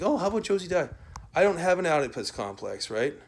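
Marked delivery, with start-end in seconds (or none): 0.0-0.9 mock question pitched much higher; a sing-song jeer; mocking quote. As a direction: toss it off as a silly taunt. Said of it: "And then, like".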